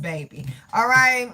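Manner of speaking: ordinary talk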